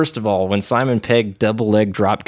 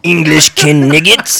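mock French taunt; 6 semitones higher, 9 dB louder, slower than usual. Quoting Monty Python taunts.

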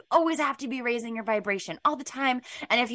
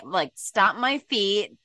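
usual speaking voice

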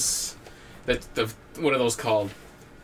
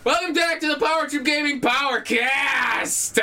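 hyped intro shout; 11 semitones higher than usual. Pretend. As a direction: shout the welcome like a wrestling hype man. Show host yells the intro.

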